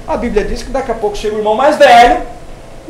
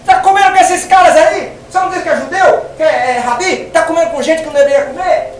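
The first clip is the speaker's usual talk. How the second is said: accusing; 7 semitones higher than usual. Retold or imitated. Accusers challenge Jesus.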